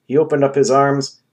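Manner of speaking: ordinary delivery, nothing marked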